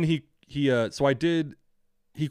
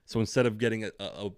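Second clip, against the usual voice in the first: normal talking voice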